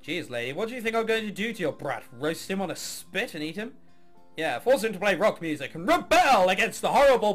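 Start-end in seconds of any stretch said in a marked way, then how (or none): none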